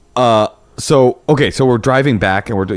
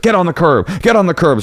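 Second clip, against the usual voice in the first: mock driver barking orders; 7 semitones higher than usual.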